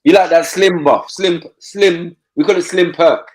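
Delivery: usual speaking voice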